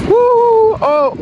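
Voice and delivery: high joyful woo; whooping. Real, not acted. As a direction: whoop down the slope. Whooping on the ride.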